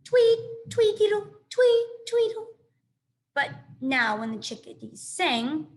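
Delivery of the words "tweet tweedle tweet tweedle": high, sung-out birdsong tweets; chickadee tweets. A narrator's reading. Narrator mimicking a chickadee's song, high and chirpy. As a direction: chirp it like a little bird. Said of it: "their songs had sounded like".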